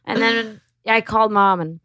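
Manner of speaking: normal talking voice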